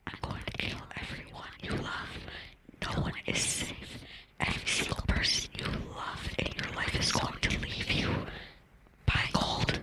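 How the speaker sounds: raspy menacing whisper; horror trailer voice. Acted. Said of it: "this is exactly what he sounds like".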